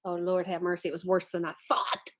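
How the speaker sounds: ordinary delivery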